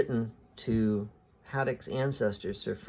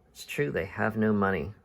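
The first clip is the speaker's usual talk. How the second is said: ordinary delivery, nothing marked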